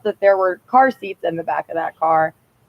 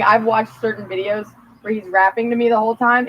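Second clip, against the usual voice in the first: ordinary talk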